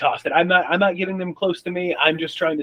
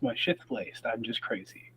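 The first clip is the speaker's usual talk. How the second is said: much softer, sinking into a daze; muttered to self; 5 semitones lower, 13 dB quieter than usual. Acted. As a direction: mumble it, the realisation settling in. Character mumbling to himself, stunned.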